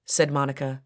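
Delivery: plain reading voice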